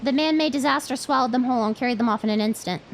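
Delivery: usual speaking voice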